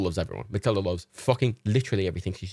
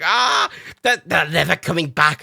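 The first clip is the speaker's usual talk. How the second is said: higher, louder, slower wailing; frantic dog voice; 6 semitones higher, 5 dB louder, slower than usual. Retold or imitated. Dog sure her owners are never coming back, wailing higher and louder. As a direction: whine it desperately. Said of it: "my impressions of Reagan and Matilda".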